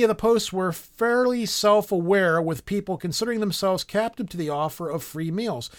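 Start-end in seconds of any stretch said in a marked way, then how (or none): none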